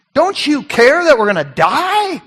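high, indignant challenge with a touch of sarcasm; acted reproach, almost sarcastic; near-sarcastic plea. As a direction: plead accusingly, voice lifted high on the question. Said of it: "Almost sarcasm in their voices here".

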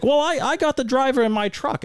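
very high mocking imitation; mimicked excuse. Racer imitating the spotter's excuse, mocking high voice. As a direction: mimic a flustered opponent, voice way up.

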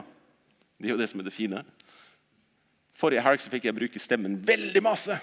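normal talking voice

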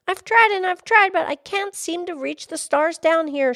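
high, loud complaint; high-pitched frustrated lass; little girl crying out. Acted. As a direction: wail it in a high child's voice, no sobs. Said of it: "she cried".

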